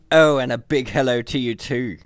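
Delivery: lightly voiced grumbling computer; a hint of a sulky computer. A narrator's reading. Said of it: "grumbled the computer".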